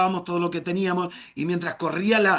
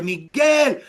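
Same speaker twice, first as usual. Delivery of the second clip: mother calling; 7 semitones higher, 6 dB louder than usual. Retold or imitated. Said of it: "si me lo dijo, yo no escuché".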